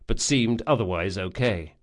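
plain reading voice

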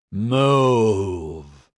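long drawn-out bellowed command. A narrator's reading. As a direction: bellow it at a crowd, stretching the word. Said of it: "he bellowed at them".

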